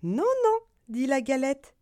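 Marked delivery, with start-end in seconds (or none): 0.0-0.6 squeaky little voice. Acted. Galette refuses the wolf.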